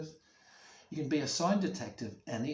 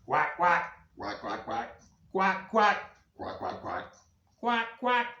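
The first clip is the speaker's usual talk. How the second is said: big ducks answering each other in pairs; grown-up duck; 6 semitones higher, 6 dB louder than usual. Acted. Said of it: "making a more grown-up duck sound".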